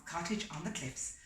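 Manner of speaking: ordinary delivery, nothing marked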